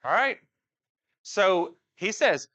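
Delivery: normal talking voice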